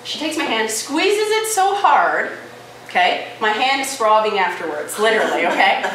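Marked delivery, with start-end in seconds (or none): none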